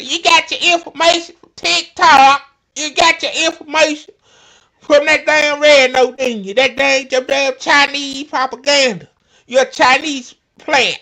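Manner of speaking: high, loud shrill-free mock rant; mocking her. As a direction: jab it like an outraged Karen. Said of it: "I'm shocked she said".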